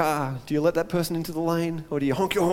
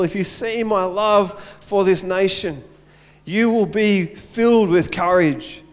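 preaching at full force; 4 semitones higher than usual. Acted. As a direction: build it up like a preacher's climax.

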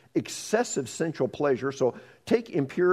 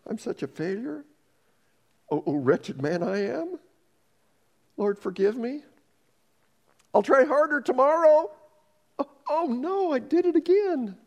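higher, rising into anguish; hammed-up despair; 11 semitones higher than usual. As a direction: wail at yourself, mock wretched. Acted despairing sinner.

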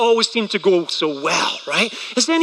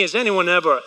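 ordinary delivery

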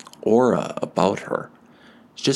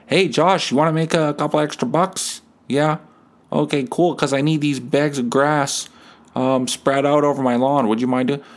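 neighbour asking a favour; 6 semitones higher than usual. Retold or imitated. Neighbour asks Josh for help.

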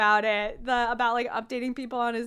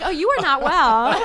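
laughing mock diagnosis; 3 semitones higher, 10 dB louder than usual.

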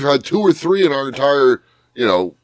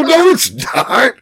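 high pleading defense; 9 semitones higher, slower than usual. Retold or imitated. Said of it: "I go".

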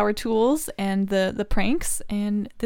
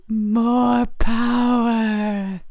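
slow grunting more power; 5 dB louder, much slower than usual. Acted. Tim Taylor catchphrase parody.

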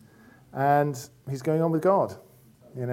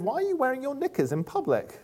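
put-on high, soft little-girl question; young daughter; 11 semitones higher, 4 dB quieter than usual. Young daughter questioning a lady by the pool, high child voice. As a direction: imitate a small child asking, pitched well up. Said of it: "our young daughter went up to this lady".

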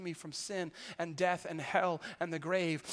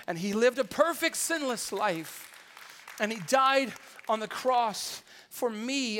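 swelling louder on perfect, then slowing down; emphatic preaching; 5 semitones higher, 9 dB louder, slower than usual. Real, not acted. Preacher to congregation on Jesus dying for him, rising emphasis. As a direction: lean hard into the sacrifice, slow and weighty. Preacher stressing Christ's sacrifice.

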